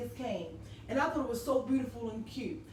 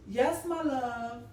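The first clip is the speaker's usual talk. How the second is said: tender loving answer; much slower than usual. Retold or imitated. Old wife answering her husband on speaker, tender and slow. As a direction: answer like he's the love of your life.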